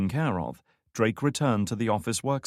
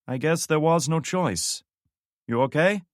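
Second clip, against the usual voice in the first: higher, louder voice over the radio; relieved radio commander; 8 semitones higher, 4 dB louder than usual. Acted. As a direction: ask with cautious relief.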